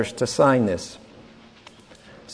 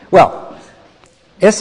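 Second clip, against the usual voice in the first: ordinary talk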